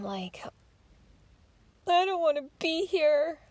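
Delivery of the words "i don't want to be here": crying on the phone. Retold or imitated. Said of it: "and I'm crying".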